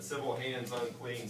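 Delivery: usual speaking voice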